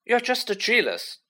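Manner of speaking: high, piping protest. A narrator's reading. Colin defending Harry to Malfoy, piping up. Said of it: "piped up Colin".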